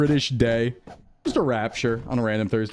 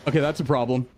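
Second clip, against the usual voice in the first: ordinary talk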